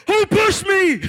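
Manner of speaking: high-pitched club-piercing bellow; very loud shout. Retold or imitated. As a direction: bellow it over the club noise, drawn out. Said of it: "How are you so loud".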